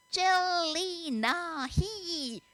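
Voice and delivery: hugely drawn-out, high-pitched syllables; very slowly. A narrator's reading. American boy orders from the gaping server, very slowly. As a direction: stretch each vowel as if to a dimwit. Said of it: "he said very slowly".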